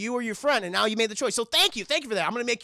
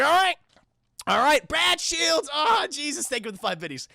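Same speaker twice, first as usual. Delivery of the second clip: high, loud, slowed mock thanks; mock grateful patient; 6 semitones higher, 4 dB louder than usual. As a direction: ham up the patient's gratitude. Retelling patient thanking doctor.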